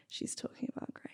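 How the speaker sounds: gossipy whisper. Retold or imitated. Mimicking commenters' gossip.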